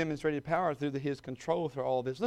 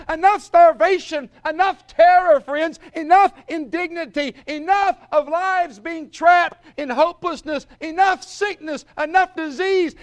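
high, loud shouted litany; shouting; 12 semitones higher, 15 dB louder than usual. Retold or imitated. Preacher voicing the Lord's shout at the resurrection, loud and high. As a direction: hammer each enough like a proclamation. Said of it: "He's gonna shout".

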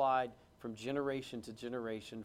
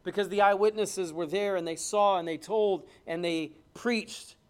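louder, building fervent preaching; preaching at full force; 6 semitones higher, 10 dB louder than usual. Acted. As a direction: rouse the congregation, hammering each phrase.

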